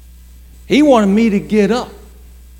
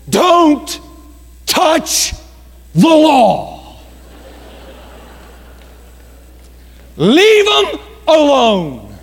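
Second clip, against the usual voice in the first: each word punched out, louder and higher; emphatic sermon point; 8 semitones higher, 4 dB louder, much slower than usual. Real, not acted. Preacher driving home the lesson to the church, punching each word. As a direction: hammer home Don't, touch, the law. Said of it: "Here's the point".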